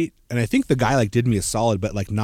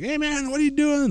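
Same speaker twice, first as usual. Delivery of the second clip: much higher, casual dude voice; mock young partier. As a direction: toss it off like a tipsy kid. Voicing drunk kids on train.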